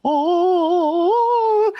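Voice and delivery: mock surprise. Retelling a stunned reaction.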